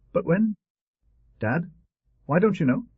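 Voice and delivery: put-on high-pitched child's questioning; insistent small boy. A narrator's reading. Small boy asks his father when uncle will come, insistent. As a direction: press Dad in a little boy's high voice.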